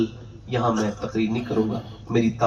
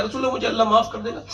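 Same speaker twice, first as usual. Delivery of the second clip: anxious plea; 10 semitones higher, faster than usual. Retold or imitated. Companion anxiously asking the Prophet.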